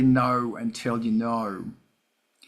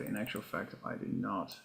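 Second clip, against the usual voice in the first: quiet aside; 6 semitones lower, 15 dB quieter than usual. Real, not acted. Confessing he's not together.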